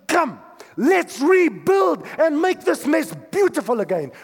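shouted high and loud, a rallying cry; Jesus calling out, come rebuild; rousing call. Acted. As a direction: call them to rebuild at full voice. Preacher voicing Jesus' call.

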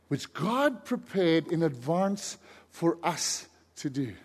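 slow, measured Bible reading; unhurried reading with weighty pauses; scripture reading. Real, not acted. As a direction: read it deliberately, phrase by phrase. Reads scripture aloud.

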